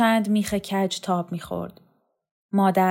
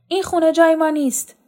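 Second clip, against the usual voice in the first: much higher and louder, hurled outburst; angry declaration; 9 semitones higher, 6 dB louder, faster than usual. Acted. Son defying his mother after smashing the saucer, angry cry.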